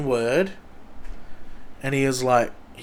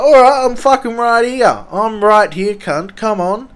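put-on high, loud taunting yell at the other kid; yelled challenge; 10 semitones higher, 10 dB louder than usual. Kid yelling a fight challenge across the road, loud taunting yell. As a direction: shout him down, come at me.

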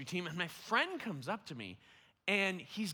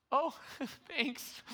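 high, feeble laughed-out thanks; mock-grateful with a pained little laugh; wounded thanks; 10 semitones higher than usual. Man thanks the friend who rejected his word, hurt but laughing.

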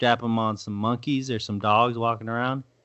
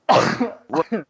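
sudden hacking cough; coughing fit; 9 semitones higher, 4 dB louder than usual. Real, not acted. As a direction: cough hard, something caught. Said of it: "I think I swallowed a bug".

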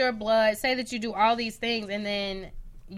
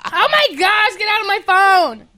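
higher, louder yell; loud protest; 9 semitones higher, 10 dB louder than usual. Real, not acted. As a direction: shout it again in outrage. Repeated outrage.

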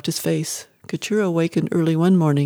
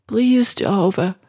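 slow, higher-pitched begging; desperate plea; 4 semitones higher than usual. A narrator's reading. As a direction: beg God, drawing it out. Desperate mother prays for her son.

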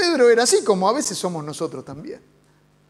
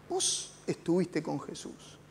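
hushed accusation; 5 semitones lower, 13 dB quieter, slower than usual. Acted. Servant woman accusing Peter.

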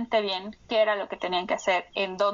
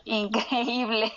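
risa al hablar; slower than usual. Real, not acted. Laughing as she sums up.

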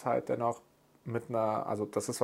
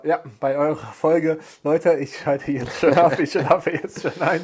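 slow, louder bored viewer drawl; 3 semitones higher, 8 dB louder than usual. Retold or imitated. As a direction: play a viewer nodding off. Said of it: "Jetzt denken sich gerade so die Zuschauer". (gelangweilter Zuschauer, langsam und laut)

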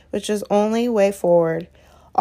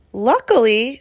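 shrill disbelieving shriek, higher and louder; 3 semitones higher, 5 dB louder than usual. A narrator's reading. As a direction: shriek the word back in disbelief. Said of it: "shrieked Hermione".

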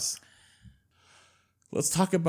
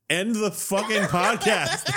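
pleading through laughter; giggling exasperated; laughing; 6 semitones higher, slower than usual. Real, not acted. Laughing plea.

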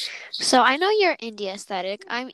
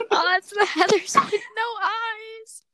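mock friend quote; 11 semitones higher, 4 dB louder than usual. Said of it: "friends was just like".

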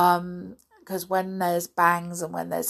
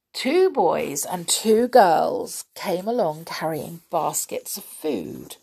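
ordinary delivery

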